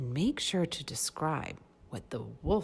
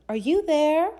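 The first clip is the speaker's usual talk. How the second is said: high, loud call into the house; loud searching call; 13 semitones higher, 11 dB louder than usual. Acted. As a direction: call out for grandma, higher and louder. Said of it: "she called".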